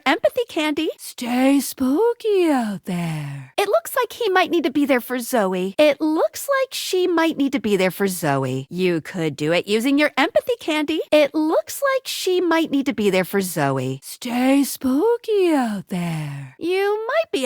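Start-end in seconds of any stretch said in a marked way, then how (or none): 1.0-3.5 slow, drawn-out spooky voice; eerie farewell, words drawn long; spooky send-off. Acted. Spooky sign-off in game. 14.0-16.5 slow, drawn-out spooky voice; eerie farewell, words drawn long; spooky send-off. Acted. Spooky sign-off in game.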